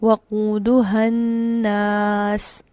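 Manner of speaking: very slow chanted tajweed; Quran recitation. Shown as a demonstration. Teacher recites another example, very slow tilawat. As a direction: draw the phrase out melodically. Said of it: "इसी तरह एक और मिसाल है".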